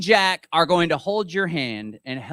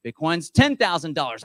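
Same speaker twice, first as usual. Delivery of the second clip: normal talking voice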